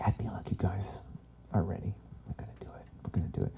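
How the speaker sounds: hushed, excited whisper. Real, not acted. Speaker whispering to audience before exercise, hushed. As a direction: lean in and whisper the secret. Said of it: "whispered buildup".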